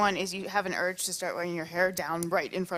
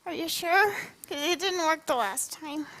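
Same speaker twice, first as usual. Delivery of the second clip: high creature voice; 8 semitones higher than usual. Acted. DM voices the monsters.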